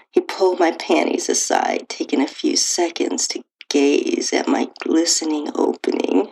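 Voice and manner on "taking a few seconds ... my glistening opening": usual speaking voice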